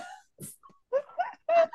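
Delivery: high, drawn-out laughing exclamation; slow gasp of real laughter; laughing hard. Real, not acted. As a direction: wail it through giggles. Cracking up at balloon joke.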